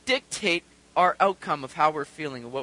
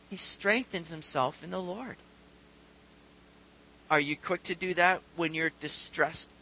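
normal talking voice

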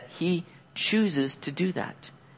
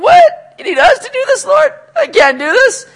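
high, loud panicked protest; hammed-up tearful freak-out; 10 dB louder than usual. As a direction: shriek it in overwhelmed disbelief. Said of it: "And they're freaking out".

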